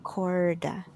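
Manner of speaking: corda with an English R; English-style R. Shown as a demonstration. Teacher shows the countryside corda, English R. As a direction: say corda with an American R. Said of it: "the same way as the English R".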